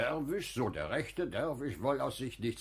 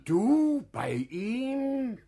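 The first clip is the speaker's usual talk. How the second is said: incredulous; 11 semitones higher, 5 dB louder, much slower than usual. Acted. Unglaube über Schatzmeisteramt.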